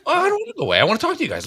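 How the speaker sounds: high, whiny mock-female voice; imitating college girl. College girl wanting to keep talking, imitated in high pitch.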